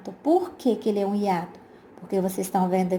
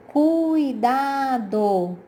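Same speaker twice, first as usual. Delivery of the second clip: syllables drawn out; 3 semitones higher, much slower than usual. Shown as a demonstration. Teacher shows the class the word cuidado, slow and stretched. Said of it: "a palavra cuidado".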